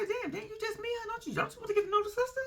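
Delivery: high, fast disbelieving squeal; incredulous high voice. Retold or imitated. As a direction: shriek it like you can't believe it. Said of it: "And I say".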